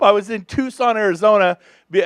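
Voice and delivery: usual speaking voice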